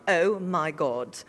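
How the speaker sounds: slow, drawn-out British imitation; badly faked British exclamation; mock British accent. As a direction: put on an exaggerated English accent, slowly. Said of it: "that's your British accent".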